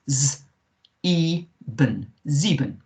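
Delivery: "z, eben, sieben" split, then blended; sounded-out E. Shown as a demonstration. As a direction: buzz "z", pause, add "eben" with long ee. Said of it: "it's the E sound".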